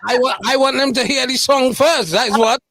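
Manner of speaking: host teasing guest to save the story for after the song, mock-stern; mock-stern interruption. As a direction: playfully cut the guest off and hold the floor. Host playfully cuts guest off.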